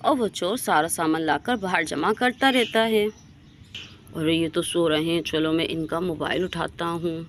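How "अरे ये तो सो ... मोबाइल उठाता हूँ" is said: low, hushed and sly, pleased with the loot; sneaky thief whisper-talk. Acted. Thief to himself in the sleeping colony, hushed and sly. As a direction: keep it down so nobody wakes.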